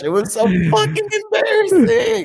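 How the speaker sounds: laughing through the words; high, breaking into laughter; stifled giggles. Real, not acted. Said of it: "'Cause I'm trying not to laugh while I tell it".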